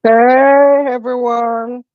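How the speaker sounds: ordinary talk, nothing marked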